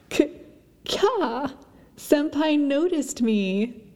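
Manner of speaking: high squeal, slow and soft; excited anime fan. Acted. Fan squeals about being noticed.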